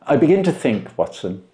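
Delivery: measured, knowing detective voice; Holmes voiced. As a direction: lay out the deductions with calm assurance.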